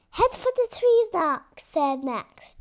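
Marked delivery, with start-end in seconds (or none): 0.1-1.6 loud commanding call; urgent order. Acted. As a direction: bark the order at the pilot. Max gives urgent order.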